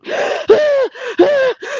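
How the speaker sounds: sharp high gasp for air, imitating the crying daughter; hyperventilating gasp. Daughter on the phone to her mother, imitated hyperventilating gasp. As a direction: grab a quick panicked breath like a sobbing child. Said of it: "That's how she was hyperventilating".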